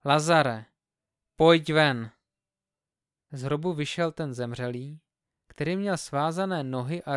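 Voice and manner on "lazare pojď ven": a loud, commanding call out to the tomb. A narrator's reading. Jesus calling dead Lazarus out of the tomb, a loud call. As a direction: cry the name out with full voice. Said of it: "zvolal velikým hlasem".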